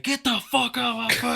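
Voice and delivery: sudden high, loud furious bark; drill instructor yell. Retold or imitated. Drill instructor yelling at the recruit to scram, furious shout. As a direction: switch instantly into a screaming drill sergeant.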